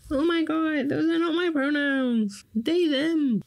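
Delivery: high, far mock-offended squeal; mock outrage. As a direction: shriek it like someone deeply offended. Mock offended reaction to pronoun question.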